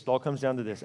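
quiet echo. Shown as a demonstration. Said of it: "repeated, lowered".

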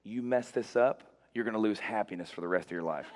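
low, measured warning in the ear; mock parental threat. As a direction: lean in and warn the child. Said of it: "I leaned in her ear".

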